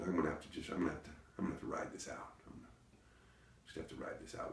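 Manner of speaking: low, hushed talking to yourself; resigned inner thought. Retold or imitated. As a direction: think it through under your breath. Resigned thought while hiding.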